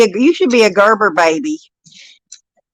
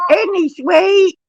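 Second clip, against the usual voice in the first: "Isn't he sweet?" cooed much higher and drawn out; cooing high and slow; 10 semitones higher, slower than usual. Real, not acted. Nanny cooing to her grandson, higher and slower.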